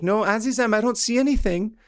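high-pitched, obliging phone voice; radar man on phone. Retold or imitated. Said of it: "And he's like".

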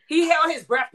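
high, emphatic outburst; loud interjection. Real, not acted. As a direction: shout out the rebuttal over your co-host. Heated playful debate, interjecting.

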